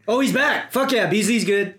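cheering the player's return; excited. Real, not acted.

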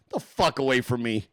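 ordinary delivery

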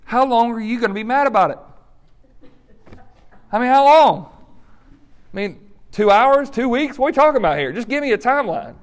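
mock an impatient offender wanting a deadline; impatient demand. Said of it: "Another comment sometimes we'll say".

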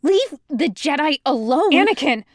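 loud, high urgent shout. A narrator's reading. Cadets tumbling out of the bunk room, shouting. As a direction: call out at full voice over the commotion. Cadets yelling as they burst out.

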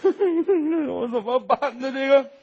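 put-on slow, high, teary wail to the old master; tearful plea. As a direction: sob it out slowly, helpless. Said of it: "眼泪儿先下来了". (哭着慢声哀求)

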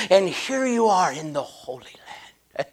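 whispered, awed tour guide; a guide whispering to pilgrims in awe; mock reverent whisper. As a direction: breathe it out like a sacred secret. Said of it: "laying on this thick religious thing".

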